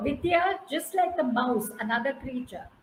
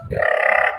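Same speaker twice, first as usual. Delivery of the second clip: loud unvoiced brrr of scurrying down; brrr sound; 8 dB louder than usual. Squirrel rushing down the tree, loud brrr. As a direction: blow a rough, loud brrr. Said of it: "And he goes".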